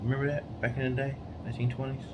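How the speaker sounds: quiet playful ribbing; soft teasing. Real, not acted. Husband teasing his wife about her age, quietly. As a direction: murmur it as a sly joke.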